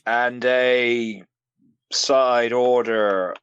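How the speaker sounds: slow, halting, self-serious; ponderous hero ordering fast food; dragged-out Optimus joke. Acted. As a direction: pause heavily between each phrase. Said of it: "stop dragging this joke out".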